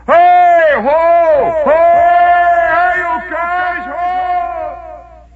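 very high, loud long-distance call; hollering across plains. Retold or imitated. Kid hollering across the flat Midwest to Iowa, loud. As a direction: call out to a kid miles away. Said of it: "you can holler".

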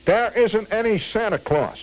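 high-pitched mock-bratty announcement; taunting proclamation. Boy's taunting quote in poem.

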